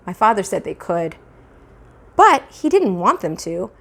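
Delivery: ordinary delivery, nothing marked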